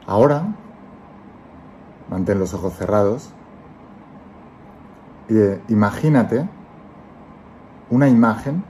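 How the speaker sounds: calm guiding voice, let each phrase settle; guided meditation. Acted. Leads a guided visualization.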